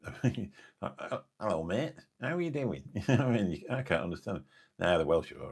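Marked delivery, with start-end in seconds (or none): 1.0-2.8 imitated rural Lincolnshire accent, pitch swooping up at the end. Streamer mimicking people from the flatlands of Lincolnshire, imitated local accent. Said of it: "there's some people that sound".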